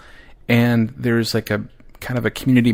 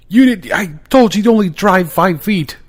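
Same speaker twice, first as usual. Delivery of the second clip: exasperated shout; 9 semitones higher, 5 dB louder than usual. Retold or imitated. Dad confronting daughter.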